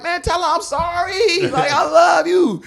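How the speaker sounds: high hammed-up plea; mock pleading. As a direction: beg her back, overdone.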